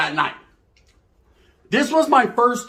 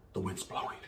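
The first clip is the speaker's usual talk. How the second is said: whisper; 18 dB quieter than usual. Retold or imitated. Seductive whisper to his date.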